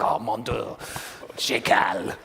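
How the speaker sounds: put-on a put-upon French developer sighing in his accent; French angry developer. Host mocking a French developer, in a French accent. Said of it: "that's my French angry developer guy".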